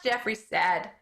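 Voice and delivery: ordinary delivery, nothing marked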